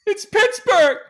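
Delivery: high, loud, strained party-guy yell; hammed-up drunk Pittsburgh bro shouting; impression of a rowdy friend. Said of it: "That's what he does".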